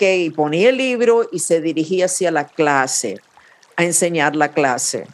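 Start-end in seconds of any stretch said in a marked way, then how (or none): none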